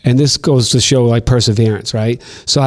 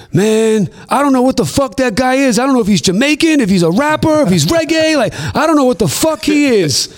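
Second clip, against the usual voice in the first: much higher, excited mock-baffled voice; imitating K Slade; 11 semitones higher than usual. K Slade baffled by Cardinal, high mocking voice. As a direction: play a bewildered friend, pitched way up.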